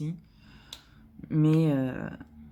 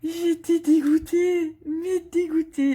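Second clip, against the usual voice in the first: much higher, louder and slow, stretched out in mock despair; hammed-up dismay; 11 semitones higher, 5 dB louder, slower than usual. Real, not acted. Vlogger to viewers about the sold-out painting, hammed-up dismay. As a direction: wail it like a drama queen. Exaggerates her disappointment.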